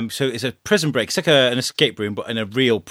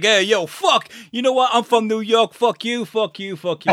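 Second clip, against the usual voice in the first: pitched-up, brash New York guy; hammed-up New Yorker cursing everyone; New York accent; 9 semitones higher than usual. Actor replaying Wednesday Night Live, brash New York accent. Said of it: "I was a guy from New York".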